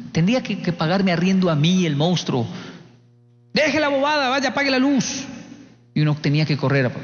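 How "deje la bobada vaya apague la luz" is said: higher, sharp mock scolding of a scared child; scolding grandfather. Abuelo regañando al nieto asustado, mock stern scolding. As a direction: bark the order like a stern grandfather.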